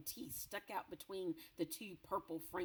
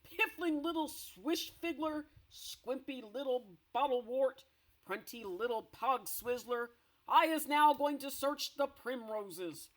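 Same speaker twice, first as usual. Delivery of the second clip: insulting giant; 6 semitones higher, 8 dB louder than usual. Acted.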